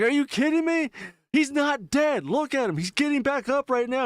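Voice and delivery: very high, frantic mock protest; retold exasperated panic. Son to dad about the zombie getting up, mock frantic. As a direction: imitate a son arguing in disbelief.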